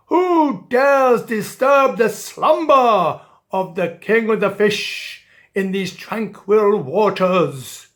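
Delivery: loud, slow booming royal voice; displeased king. Acted. King of the Fish woken by dolphins, a loud slow booming voice. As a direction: boom out like a woken, cross monarch. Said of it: "he wasn't pleased".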